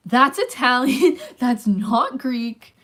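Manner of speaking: loud, slow, mock-indignant correction; exasperated protest. Retelling their protest to Sean about the accent, exasperated and loud. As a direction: correct him loudly, drawing out each word.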